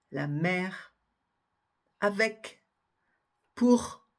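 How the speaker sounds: mère and pour ending in a clear R, avec in K; mère, avec, pour spoken one by one, endings sounded; sounded final consonants. Shown as a demonstration. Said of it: "prononcez bien cette, euh, consonne finale".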